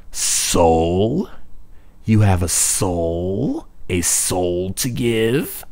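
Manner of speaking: slow, drawn-out witch voice, rising questions; mocking witch. Witch questioning Kiko's offered soul, slow mocking witch. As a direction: tease her like a sly old witch.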